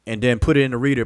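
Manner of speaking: ordinary talk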